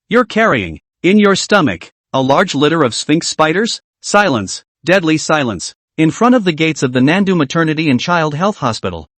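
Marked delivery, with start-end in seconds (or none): none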